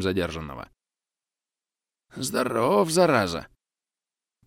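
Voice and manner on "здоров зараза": grunting with strain. Acted. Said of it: "кряхтит Олег".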